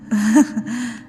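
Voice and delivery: a short amused chuckle; light laugh. Acted. Ghost lady laughs at her visitor's kindness, chuckling.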